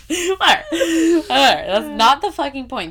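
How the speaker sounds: loud, laughing through the words; giggling exasperation, loud. Real, not acted. Laughing at her own rambling.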